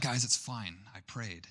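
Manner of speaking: soft and unbothered, fading to almost nothing; quiet calm. Retold or imitated. Brother reassuring the frightened family, quiet and unbothered. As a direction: shrug it off gently. Brother calmly shrugs off danger.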